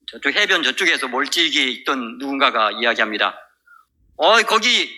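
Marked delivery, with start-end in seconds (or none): 4.2-4.9 high-pitched call across the water. Retold or imitated. Someone on shore hails the fishermen, calling out. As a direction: imitate someone hailing a far-off boat. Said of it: "누군가 소리치는 거예요".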